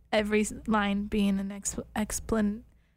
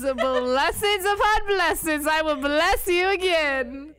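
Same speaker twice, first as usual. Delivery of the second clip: higher, slow preacher cadence; mock Southern Baptist pastor; 6 semitones higher, 4 dB louder, slower than usual. Defending her email sign-off, mock Southern Baptist pastor. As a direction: stretch it out like a pastor blessing the flock. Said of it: "You sound like a Southern Baptist pastor".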